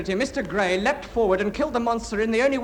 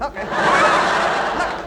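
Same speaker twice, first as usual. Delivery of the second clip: mocking laugh; 6 semitones higher, 6 dB louder than usual. Hideous creature mocked.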